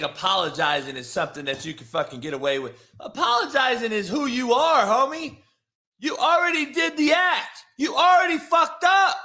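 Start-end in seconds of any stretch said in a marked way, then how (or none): 3.0-9.2 high-pitched indignant shouting, building; exasperated yelling at the chat; heated accusation. Real, not acted. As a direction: drive it home, getting higher and more worked up. Heated rant at chatters.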